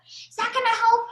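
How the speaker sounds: usual speaking voice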